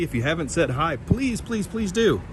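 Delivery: ordinary delivery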